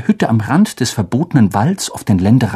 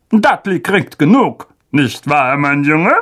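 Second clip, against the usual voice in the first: higher, tense blustering uncle; angry uncle; 3 semitones higher than usual. Acted. Uncle refusing Harry's request, tense and higher. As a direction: bluster at the boy.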